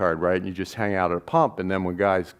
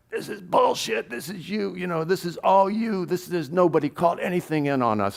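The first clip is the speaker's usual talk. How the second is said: much higher, heated mock rant at the cop; retold angry outburst; 10 semitones higher than usual. Swank telling off the sergeant at the pump, high heated rant. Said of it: "he just went off on this guy".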